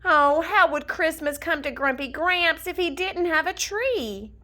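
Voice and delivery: ordinary delivery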